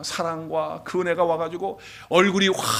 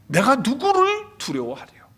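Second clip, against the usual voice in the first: bold proclamation; 6 semitones higher, 3 dB louder than usual. Acted. Preacher proclaims the psalm verse.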